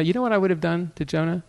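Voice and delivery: normal talking voice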